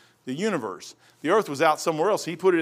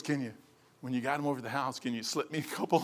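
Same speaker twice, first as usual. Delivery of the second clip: lightly voiced mother, much softer; soft mom voice; 6 semitones lower, 11 dB quieter, faster than usual. Mother quietly begging her son over her pills, softer. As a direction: murmur it like a pleading old mom.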